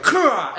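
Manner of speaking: a short imitated strike cry; retold karate shout; kick yell. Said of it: "he just like".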